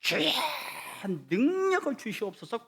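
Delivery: mimicking a student crying out in prayer; high pleading prayer call; mock fervent prayer cry. Student's loud prayer imitated.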